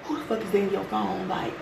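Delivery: hushed, accusing undertone; retold low-key telling-off, kept very quiet; cussing on the low. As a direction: cuss them out under your breath. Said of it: "cussing this motherfucker out on the low".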